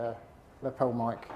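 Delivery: ordinary talk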